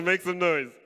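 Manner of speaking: usual speaking voice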